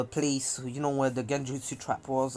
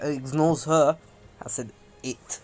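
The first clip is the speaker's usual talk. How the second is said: ordinary delivery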